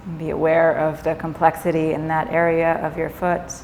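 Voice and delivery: calm yoga nidra guidance with long pauses; guided meditation. Acted. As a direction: ease them gently into rest, leaving space between phrases.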